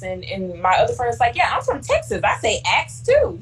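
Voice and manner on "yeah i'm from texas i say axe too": friend from Texas. Retold or imitated. Friend voiced in story.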